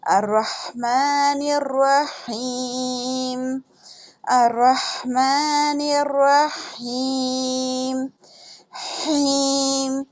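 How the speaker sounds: हीम with the ha breathed from the throat, drawn out. Shown as a demonstration. Teacher showing students the throat ha, slow recitation. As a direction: chant अर्रहमानिर्रहीम slowly, ha from the throat. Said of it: "ये हा हलक से निकलेगा".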